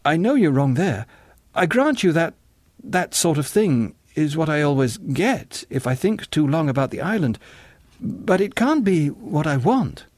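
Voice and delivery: high, puzzled protest; earnest, protesting John. A narrator's reading. John argues with the singer.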